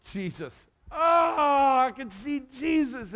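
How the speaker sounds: words caught in a real sob; real choked-up cry. Real, not acted. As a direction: voice breaking with emotion. Recalling the moment of conversion.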